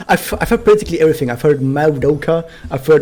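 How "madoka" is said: MADOKA drawn out as "mow-doh-ka"; too bumpy. Shown as a demonstration. Streamer lists versions they have heard, too bumpy. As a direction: make the vowels of "Madoka" glide and bump. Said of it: "way too bumpy".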